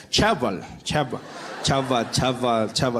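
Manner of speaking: "Chabal" for Trevor, ch for tr; guard mispronouncing Trevor. Acted. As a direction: slowly try out "Chabal" as a botched Trevor. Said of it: "He's like".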